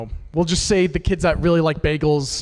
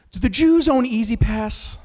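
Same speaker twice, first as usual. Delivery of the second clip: put-on kid's question; 6 semitones higher, slower than usual. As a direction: ask it slowly like a puzzled schoolkid. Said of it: "They go".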